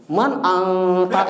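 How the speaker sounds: "Man anta" drawn out with a held nasal ng before ta; slow "Man anta", humming the n of anta; tajwid ikhfa demo. Shown as a demonstration. Teacher showing what not to do in conversation, tajwid ikhfa.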